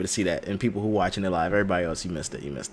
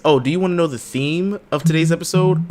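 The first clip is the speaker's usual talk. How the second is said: normal talking voice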